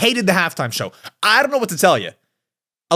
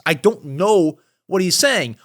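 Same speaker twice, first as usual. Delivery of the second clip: ordinary talk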